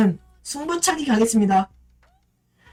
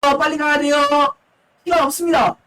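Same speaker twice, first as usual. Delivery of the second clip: esports caster; 7 semitones higher than usual. Acted. Final whistle call.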